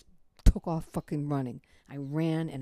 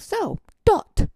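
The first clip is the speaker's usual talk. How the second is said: high, loud, tight-lipped impression of him; 9 dB louder than usual. Man confronting his date over dinner, mock tight-lipped voice. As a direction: say it through a very tight lip, mockingly. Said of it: "he said with a very tight lip".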